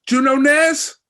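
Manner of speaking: big brash New York American accent, hammed up; Kevin Kelly's accent. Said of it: "the first thing he goes to me is he goes".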